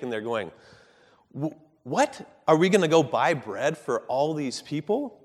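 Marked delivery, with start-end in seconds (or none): 1.3-5.2 high, louder incredulous what; indignant disbelief. Retold or imitated. Disciples protesting to Jesus, offended disbelief. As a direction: disciples balking at feeding the crowd. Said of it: "you can just sense the offense that the disciples take".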